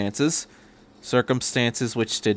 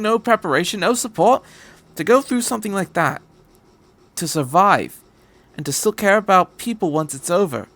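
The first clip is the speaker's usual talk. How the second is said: higher, insistent encouragement; lightly voiced earnest doctor; 8 semitones higher than usual. Doctor reassuring the boy pilot, insistent. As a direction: build him up, word by word. Doctor comforting Shinji at length.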